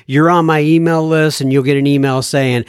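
usual speaking voice

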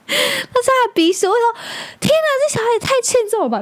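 laughing in disbelief at her son's booger; laughing exclamation. Real, not acted. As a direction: blurt it out through laughter.

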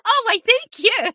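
high, loud mock-gracious thanks; playfully flattered. Real, not acted. Guest answering the host's compliment, playfully flattered. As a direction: sing out thanks with a playful flourish.

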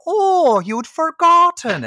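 high, loud, drawn-out mock astonishment; mocking shopkeeper. Terrible shop boss mocks her employee, hammed-up scorn. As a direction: throw it back in a hammed-up, swooping high voice. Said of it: "And she's like".

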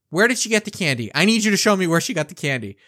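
fast, urgent demand; panicked grandmother. Retold or imitated. Grandmother demands to see where the candy came from, fast and urgent. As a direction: rush it like a worried grandmother. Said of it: "a little panic-stricken".

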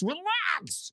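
high, strained yell of relax; exasperated high-pitched shriek; screaming relax. Acted. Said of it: "screaming, "Relax".

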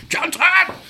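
high, loud bellow of a padre; army padre bawling at the congregation; screamed. Retold or imitated. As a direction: roar it down the church to call the room. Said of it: "Screamed down this church".

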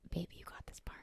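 hushed, whispered encouragement to a partner; retold whispered aside. Partner handing over part of a story, whispered aside.

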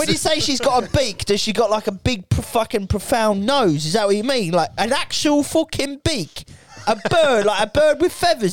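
put-on high-pitched, incredulous English accent; English pub bloke. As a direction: interrogate your mate in broad English. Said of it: "some English guys sitting around going".

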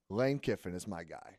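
ordinary talk

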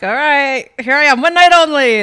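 big showy star entrance; diva stage voice. Pretend. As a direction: announce it like a pop diva. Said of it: "And then, like".

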